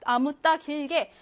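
usual speaking voice

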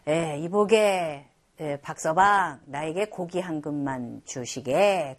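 a gentleman asking Mr. Park politely for meat; polite nobleman. Retold or imitated. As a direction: ask kindly, taking your time. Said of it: "그랬어요".

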